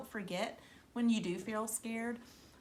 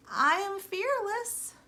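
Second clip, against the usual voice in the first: higher, slow, trying to sound brave; unsure self-reassurance; 11 semitones higher, 4 dB louder, slower than usual. Acted. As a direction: convince yourself. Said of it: "tried to convince herself".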